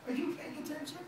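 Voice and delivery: high, mocking aside to the crowd; a mocking king turning to his court; mocking pharaoh. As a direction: sneer to the onlookers. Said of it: "He goes".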